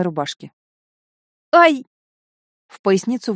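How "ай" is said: high, loud yelp of pain; sharp cry of pain. A narrator's reading. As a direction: cry out at a sudden twinge. Sudden back pain. (резкий вскрик от боли)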